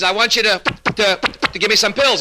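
high-pitched stammer on "to"; catch, repeat, then push the request out; stuttering patient. Acted. Patient asks psychiatrist for medicine, stuttering. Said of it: "same trouble that he's got".

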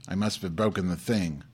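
ordinary delivery

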